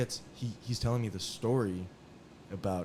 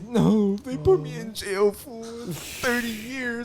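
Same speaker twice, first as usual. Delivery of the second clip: high, slow wailing mock sob, louder; fake crying; 12 semitones higher, 7 dB louder, slower than usual. Inmate fakes grief over his sentence, high wailing sob. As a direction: ham up a tearful wail. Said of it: "he's just crying".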